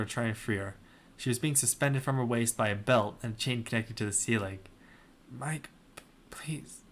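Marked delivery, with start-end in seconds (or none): 5.3-6.8 faint breathless whisper, much softer; whispered plea from a dying girl. Acted. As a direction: plead with almost no breath left. Dying friend pleads faintly.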